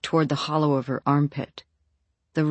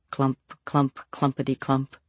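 plain reading voice

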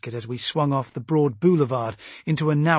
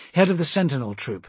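usual speaking voice

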